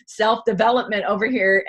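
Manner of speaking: put-on poking fun at her old self; mock scoff. Mocking her old attitude, laughing scoff.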